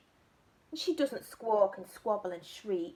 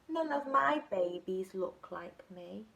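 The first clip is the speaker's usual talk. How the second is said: plain reading voice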